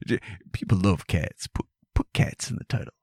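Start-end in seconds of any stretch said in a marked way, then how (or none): 1.5-2.9 whispered, conspiratorial advice. Retold or imitated. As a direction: whisper it like a secret tip. Whispered aside.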